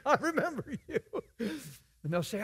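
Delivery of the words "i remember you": higher, laughing imitation; laughing mock. Preacher imitating himself recalling a failed prayer, laughing. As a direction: say it through a laugh. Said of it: "You know".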